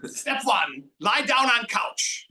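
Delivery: put-on slow, loud, high barked orders in a heavy German accent; a drill-sergeant German accent giving relaxation steps. Record ordering the guy onto the couch, slow loud German accent. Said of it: "with this heavy German accent".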